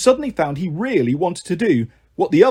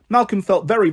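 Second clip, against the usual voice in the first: ordinary delivery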